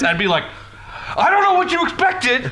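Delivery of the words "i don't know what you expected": high, laughing mock protest; high-pitched protest. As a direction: plead innocence in a much higher voice. Acting out excuse after spinning out.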